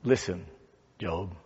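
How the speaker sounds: low, hushed voice of God; gentle whisper. Acted. God speaks softly out of the whirlwind to Job, gentle whisper. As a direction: drop it low and soft, gently. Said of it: "a gentle whisper".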